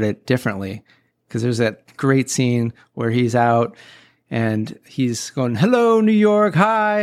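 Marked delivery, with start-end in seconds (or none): 5.6-7.0 high, drawn-out happy greeting called out; cheerful naive newcomer. Retold or imitated. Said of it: "have that Eddie Murphy Coming to America mindset".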